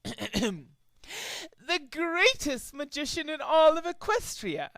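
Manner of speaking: high, showy announcement; resume the grand intro; grandiose proclamation. Acted. Trixie finishing her introduction to the crowd, high showy proclamation.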